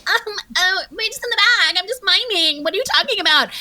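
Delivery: high, airy mock-innocent voice; sing-song fake innocence; feigned innocence. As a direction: play dumb like you did nothing wrong. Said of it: "I'm like".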